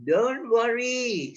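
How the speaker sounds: wicked frog. A narrator's reading. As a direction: tease the rat like a cruel frog. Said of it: "says the wicked frog".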